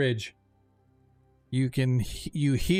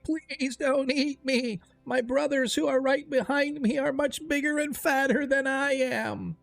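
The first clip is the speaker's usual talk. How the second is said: whiny goat creature; 12 semitones higher than usual. Acted. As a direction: beg like a frightened little goat-man. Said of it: "creature ... saying".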